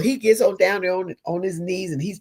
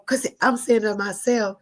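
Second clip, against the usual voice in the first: normal talking voice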